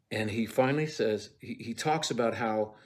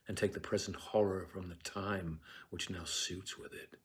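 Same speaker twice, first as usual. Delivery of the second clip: hushed, measured verse speaking; quiet classical stage reading; Shakespeare recitation; 2 semitones lower, 9 dB quieter than usual. Acted. Macbeth soliloquy recited.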